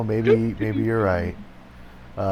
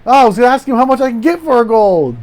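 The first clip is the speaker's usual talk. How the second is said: high, loud defensive outburst; flustered, raised-voice excuse; loud protest; 11 semitones higher, 8 dB louder than usual. Acted. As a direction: protest your innocence at full voice. Defending self in mock quarrel.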